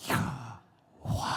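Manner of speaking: breathed Yahweh. Shown as a demonstration. Preacher insisting on the pronunciation, name said as a breath. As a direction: let the name be an audible breath. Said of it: "it was pronounced like a breath".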